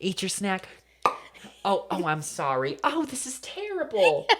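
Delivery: mock-distressed, climbing to a high wail; Natalie. Imitating castaway apologizing to a rat, mock distress. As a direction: apologize squeamishly, ending high and drawn out. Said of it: "like Natalie when she kills the rat".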